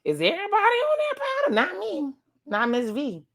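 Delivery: very high, playful squeak; mock high voice. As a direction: shoot way up high, easing down by the end. Playfully voicing scene about powder.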